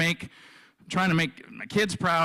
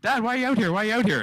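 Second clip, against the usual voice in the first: higher, childlike imitation of his daughter; nine-year-old daughter asking; 9 semitones higher, much faster than usual. Daughter asking dad why he's outside, childlike higher voice. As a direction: lift into the puzzled little girl's voice. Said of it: "and she's like".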